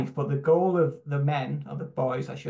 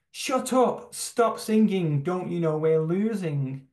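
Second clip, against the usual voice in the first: retold shout; 4 semitones higher than usual. Teammate to the singer on the sidelines, retold shout. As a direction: imitate a teammate's exasperated shout, not at full volume. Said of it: "turned to me and shouted out and shouted".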